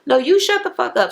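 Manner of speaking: high-pitched comeback without real volume; mock yelling. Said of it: "So she's yelling".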